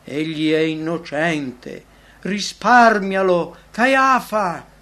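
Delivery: slow, loud desperate plea rising to a shout; hoarse echoing outcry. A narrator's reading. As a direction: cry out across a hall, drawing out each word. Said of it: "una voce rauca echeggiò nella sala".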